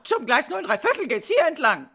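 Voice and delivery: high, loud shrill announcement. Acted. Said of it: "sondern schrill und laut".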